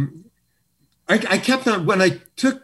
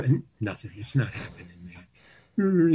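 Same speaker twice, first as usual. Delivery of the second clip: ordinary delivery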